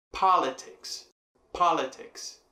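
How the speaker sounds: "politics" with a strong opening "po"; stress on first syllable. Shown as a demonstration. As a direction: punch the first syllable of "politics", then fall. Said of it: "Pá tá mais pro início". ("politics" com a primeira sílaba forte)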